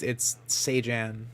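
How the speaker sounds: usual speaking voice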